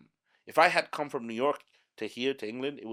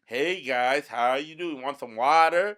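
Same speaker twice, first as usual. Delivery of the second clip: put-on loud, higher caricature of an American accent; animated American accent; 4 semitones higher, 8 dB louder, slower than usual. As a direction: exaggerate a perky American greeting. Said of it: "it's like very animated on both sides".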